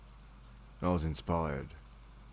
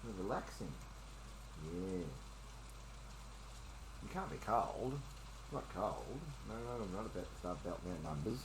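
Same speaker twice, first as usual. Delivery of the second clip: soft pet talk; 13 dB quieter than usual. Real, not acted.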